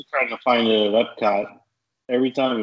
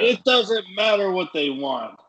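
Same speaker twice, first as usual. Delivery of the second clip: channel a wrestler's showboating interruption; The Rock; 7 semitones higher than usual. Acted. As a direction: cut in with a raised, stretched wrestling catchphrase. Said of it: "That's channeling The Rock".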